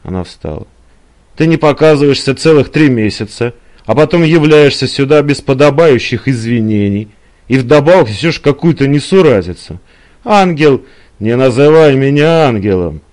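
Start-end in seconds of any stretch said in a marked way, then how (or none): 1.4-13.0 loud, higher tirade; indignant woman in a lovers' quarrel; angry Eliza. Acted. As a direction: berate him for his neglect.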